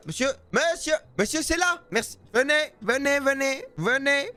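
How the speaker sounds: high, drawn-out beckoning; calling over. Real, not acted. Seller to unsteady customer, calling him to the counter. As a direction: coax a wandering customer over from afar.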